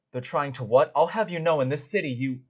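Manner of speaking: outraged guard. Acted. Guard storming in, loud and outraged. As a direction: demand an explanation, outraged. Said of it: "just go".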